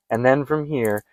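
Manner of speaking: ordinary talk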